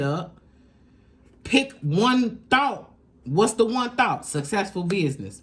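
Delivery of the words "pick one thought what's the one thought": slow, higher, insistent instruction; coaching emphasis. Shown as a demonstration. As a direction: drill the one idea in.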